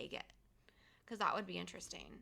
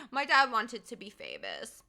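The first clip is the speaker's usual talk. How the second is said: laughing through the words, louder; 8 dB louder than usual. Real, not acted. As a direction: giggle while teasing about dad. Laughing about dad on stream.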